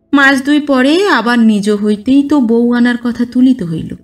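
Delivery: ordinary delivery, nothing marked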